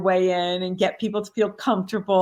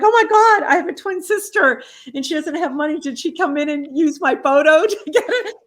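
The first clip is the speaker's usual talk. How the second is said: hammed-up shock, much higher and louder; mock shock; 9 semitones higher, 6 dB louder than usual. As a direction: play the surprise big, a fake gasp of shock. Faking surprise about twin sister.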